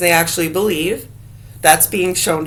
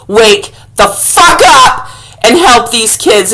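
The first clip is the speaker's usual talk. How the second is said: louder furious tirade; angry rant; 5 dB louder than usual. Real, not acted. A vlogger ranting at Augusta officials, angry and loud. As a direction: tear into the authorities.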